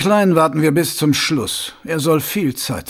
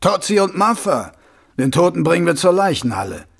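slightly raised voice; 5 semitones higher, 4 dB louder than usual. A narrator's reading. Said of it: "hob die Stimme ein wenig".

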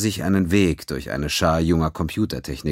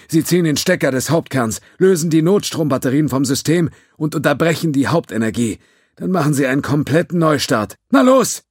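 higher, louder urgent orders; commanding; 10 semitones higher, 5 dB louder than usual. A narrator's reading. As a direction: bark out the commands. Judge giving urgent orders.

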